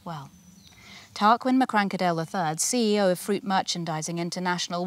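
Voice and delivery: ordinary delivery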